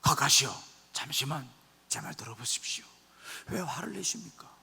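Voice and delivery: hushed, careful appeal to a superior; soothing servant. Acted. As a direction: coax an angry master very softly, nearly whispering. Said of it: "잘 달래는 거예요". (화난 주인을 조용히 달래는 목소리)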